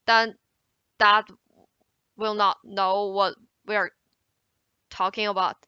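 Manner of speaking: usual speaking voice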